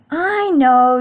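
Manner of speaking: loud, high, drawn-out "I know"; high Froggy voice. A narrator's reading. Froggy answers father about mixing, high loud voice. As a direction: answer high and long, like a kid. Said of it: "said Froggy".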